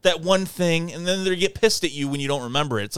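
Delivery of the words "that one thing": higher, flustered imitation; mock forgetful friend. Narrator mocking a forgetful friend, lightly flustered. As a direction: play someone groping for a word.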